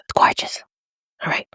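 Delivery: excited whisper; whispering with product excitement. Real, not acted. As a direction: hush it out, delighted. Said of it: "I always whisper when I get excited".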